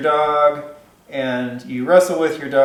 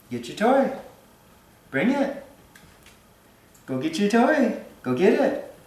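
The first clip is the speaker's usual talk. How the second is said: much higher, sing-song call to the dog; 8 semitones higher, 3 dB quieter than usual. Real, not acted. Trainer calling her dog Sugar to bring her toy, high coaxing call. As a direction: coax the dog over, pitched way up.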